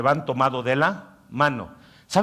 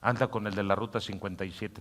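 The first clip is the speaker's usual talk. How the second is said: softer, coaxing tempter; tempting inner voice; 4 semitones lower, 8 dB quieter than usual. Acted. Pastor voicing the inner voice tempting a husband, soft coaxing. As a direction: coax it softly like a tempter. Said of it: "tu voz interior te dice".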